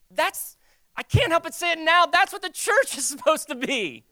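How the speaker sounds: high, loud preaching exclamation; impassioned outburst. Real, not acted. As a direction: proclaim it with a laugh breaking in. Preacher bursts out with conviction.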